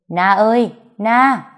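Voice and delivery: calling out loud from downstairs, hurried; urgent calling. A narrator's reading. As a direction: shout the name up the stairs in a hurry. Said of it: "rất vội vàng". (gọi to, vội vàng)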